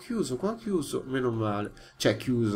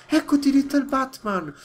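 happy greeting; 11 semitones higher, 8 dB louder than usual. Real, not acted. Greeting the pet bat.

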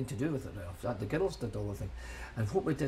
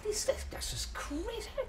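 high, breathy voice, laughing through the words; can't believe it, half laughing; laughing disbelief; 3 dB quieter than usual. Retold or imitated. Telling how crazy the crowds seemed, a high laughing whisper. Retold amazement at the queues.

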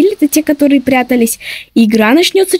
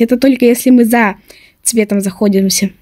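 usual speaking voice

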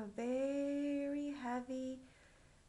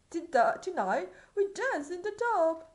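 loud royal proclamation; stagey fake archaic declaiming; 5 semitones higher, 10 dB louder than usual. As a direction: proclaim it like a merry king. King declaring.

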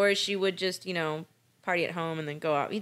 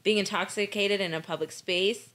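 normal talking voice

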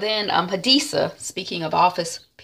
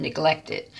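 ordinary delivery, nothing marked

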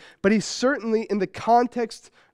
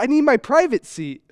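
put-on child's demand repeated higher and louder; a small girl insisting, raised up; insistent toddler; 6 semitones higher, 6 dB louder than usual. Toddler repeating herself to puzzled parents, higher and louder.

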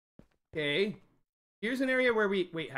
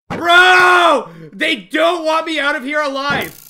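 high, loud outraged yell; exasperated shout; 6 semitones higher, 11 dB louder, slower than usual. Real, not acted. Player yelling at the creepers that won't let him escape. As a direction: cry out at the unfairness. Ambushed again in cave.

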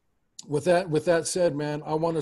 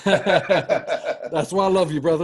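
laughing it out, louder; a real laugh breaking through the words; 6 dB louder, slower than usual. Real, not acted. To Marcus after his thanks, laughing.

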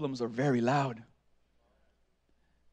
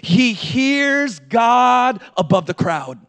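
loud, driving sermon delivery; full-force preaching; 4 semitones higher, 9 dB louder than usual. Real, not acted. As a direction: build to a booming promise, stretching the last words.

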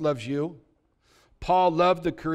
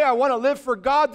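mock declaration; 6 semitones higher, 4 dB louder than usual. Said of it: "If you say".

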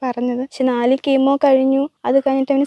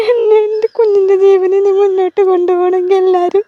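crying while pleading, voice raised; real tearful plea; 3 semitones higher than usual. Real, not acted. Mother pleading for her child's life, crying. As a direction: beg through real sobs.

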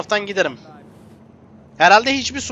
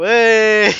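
frustrated shout; 3 semitones higher, 7 dB louder than usual. Real, not acted. Frustrated outburst at game.

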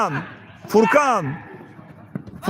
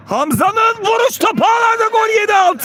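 high-pitched, sustained goal call; excited goal cry; 9 semitones higher than usual. Real, not acted. Commentator calling Hamza's goal, high excited shout. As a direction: cry out the goal and new score. Goal scored, score announced.

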